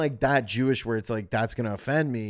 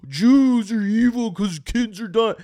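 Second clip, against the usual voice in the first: hammed-up furious protester; mock woke activist; 7 semitones higher, 8 dB louder than usual. Mocking the woke side's outrage, loud high rant. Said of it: "The woke side of this is going".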